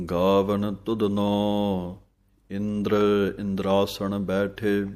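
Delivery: slow, clear scripture reading with pauses; measured devotional santhya; slow Gurbani recitation. Shown as a demonstration. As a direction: recite line by line for students to follow. Teacher recites verse slowly.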